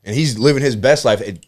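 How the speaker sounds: ordinary delivery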